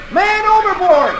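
put-on high, loud boy's alarm cry; shouting. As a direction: shout the alarm across the deck. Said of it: "Dick begins to shout".

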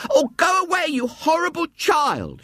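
high, piercing hysterical scream of a woman; hysterical cry. A narrator's reading. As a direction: shriek it out in fright at a horrid child. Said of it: "she cried".

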